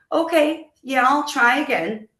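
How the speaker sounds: high, light retold agreement. Wife retells agreeing to rejoin.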